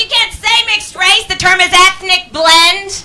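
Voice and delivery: high-pitched mock outrage; offended objectors. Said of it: "They were like".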